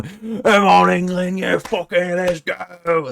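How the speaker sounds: high-pitched mock English hooligan chant; rowdy terrace supporter, slowing on the last words; football hooligan. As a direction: roar on England like a hammed-up football yob. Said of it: "doing the football hooligan one".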